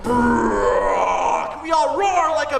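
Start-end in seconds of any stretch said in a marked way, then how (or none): 0.0-1.5 loud rumbling bear growl; a playful bear growl for the kids. Acted. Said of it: "and it went".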